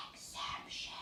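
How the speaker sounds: hushed, unvoiced whisper; playful whisper. Shown as a demonstration. Teacher naming the spelling rule to pupils, whispered. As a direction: whisper it like a secret keyword.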